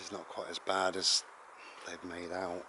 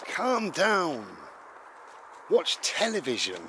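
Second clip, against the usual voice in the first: raised, high-pitched scolding order; exasperated parent; 6 semitones higher, 5 dB louder, slower than usual. Acted. Parent to overexcited child, loud exasperated telling-off. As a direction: order a wild child to settle. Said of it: "And your parents said".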